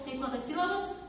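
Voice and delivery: normal talking voice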